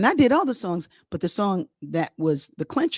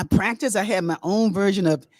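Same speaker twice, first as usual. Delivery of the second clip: usual speaking voice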